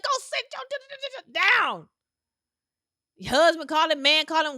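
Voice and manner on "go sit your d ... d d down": high stammering mock yell at the kid. Mom losing it at the kid, high stammering mock yell. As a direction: sputter it out like a parent at the end of her rope. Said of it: "you start yelling".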